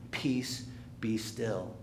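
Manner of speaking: low, soft, unforced command; simple calm command. Acted. Jesus speaking to the stormy sea, calm. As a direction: say it simply, quieting things down. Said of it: "Jesus just simply says".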